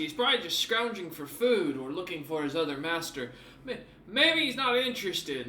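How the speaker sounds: a jittery guard fumbling for excuses; nervous guard. Acted. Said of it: "one of the guards kinda pipe up and go".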